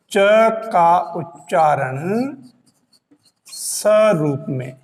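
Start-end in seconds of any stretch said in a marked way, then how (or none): none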